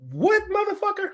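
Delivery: high squeaked outrage, what?!; incredulous shriek. Real, not acted. Vlogger reacting to Trump's letter, incredulous high yelp. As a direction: shoot up high in disbelief.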